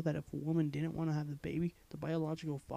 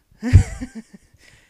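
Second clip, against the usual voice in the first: loud laugh burst; 7 semitones higher, 20 dB louder than usual. Real, not acted.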